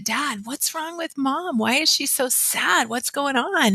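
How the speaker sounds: a light hint of a worried child; kids asking. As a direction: voice the puzzled kids asking Dad about Mom. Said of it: "they said".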